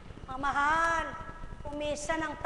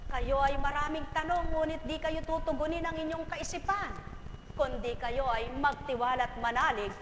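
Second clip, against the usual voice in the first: ordinary delivery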